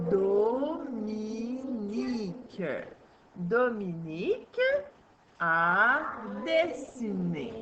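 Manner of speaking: slow syllable-by-syllable reading. Shown as a demonstration. Teacher reading syllable by syllable with pupil. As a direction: break each word into syllables. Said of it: "On va aller le lire par syllabe".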